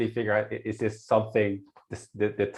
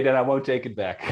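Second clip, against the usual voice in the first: words broken by laughter, a bit louder; laughing while teasing; 4 semitones higher, 4 dB louder than usual. Real, not acted. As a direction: tease her playfully through a laugh. Teasing after the handsome mix-up.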